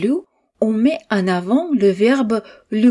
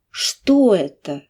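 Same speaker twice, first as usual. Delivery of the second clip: Что raised, then falling; stress on Что; slower than usual. Shown as a demonstration. Teacher to students, stress on Что. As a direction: voice up on Что, down right after. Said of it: "la voix monte sur ce mot et redescend".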